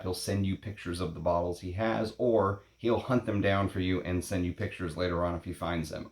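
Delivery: ordinary talk, nothing marked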